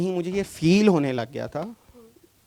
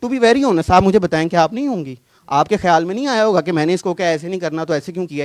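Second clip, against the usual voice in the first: heated challenge; 2 semitones higher, 9 dB louder than usual. Real, not acted. Challenging the woman to admit it, raised and fast. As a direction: fire the questions at her quickly and loudly.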